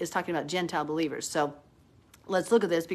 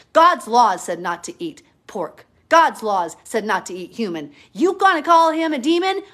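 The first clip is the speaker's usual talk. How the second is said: heated preaching; 7 dB louder than usual. Real, not acted. Preacher rebuking opponents who call God's laws bad, loud and forceful. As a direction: hammer the point home, loud.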